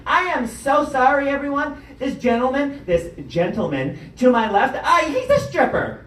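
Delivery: mock-outraged lady addressing the room; hammed-up indignant woman. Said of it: "She's all indignant".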